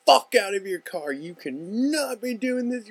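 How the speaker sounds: high-pitched, in-your-face mock rage; furious motorcyclist act, high and drawn out; mad. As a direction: chew out a careless driver, hammed-up. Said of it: "get mad and up in his face".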